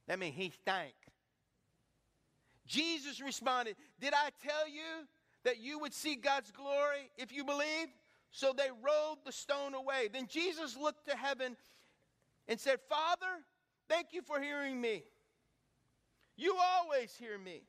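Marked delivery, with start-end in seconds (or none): 2.7-12.8 high, loud revival preaching; full-force pulpit build; fiery preaching. Acted. Preaching retelling scripture. 12.9-17.6 said loud. Retold or imitated. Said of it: "But he said it loud".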